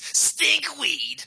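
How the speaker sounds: high nasal squeak; nasally voice. Retold or imitated. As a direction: squeeze the word out through the nose. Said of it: "in this, like, nasally voice".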